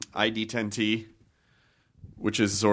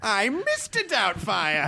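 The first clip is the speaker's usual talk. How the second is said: high, slow put-on character voice; drawn-out parody in a pitched-up voice; Mister Doubtfire; 11 semitones higher, slower than usual. Director correcting the actor as a mock British Doubtfire character. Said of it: "Missus Doubtfire".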